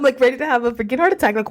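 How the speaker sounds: ordinary talk, nothing marked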